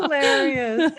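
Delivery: said through laughter; laughing. Real, not acted. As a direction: laugh as you say it. Laughing at the joke.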